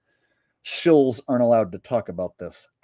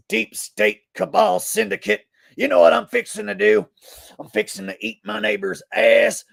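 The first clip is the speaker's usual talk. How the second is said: classic Alex Jones impression; 7 semitones higher than usual. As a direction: cut a promo like an unhinged radio host. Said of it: "I can do the classic version of Alex Jones".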